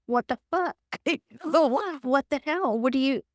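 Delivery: high, sharp angry outburst; aggressive. Shown as a demonstration. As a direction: blow up at the dirty dishes, half laughing. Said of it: "that's aggressive".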